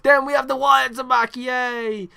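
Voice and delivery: loud, high-pitched hyped announcement; loud high yay; excited cheer. Real, not acted. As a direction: burst out cheering at the big comeback. Hyped announcement of returning wrestlers.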